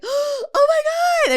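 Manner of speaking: high startled oh my God; shocked. Retold or imitated. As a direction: squeal it like she just walked in. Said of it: "I immediately was like".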